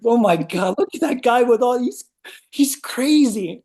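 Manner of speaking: mocking onlooker. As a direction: ham up a shocked passerby. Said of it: "I'm like".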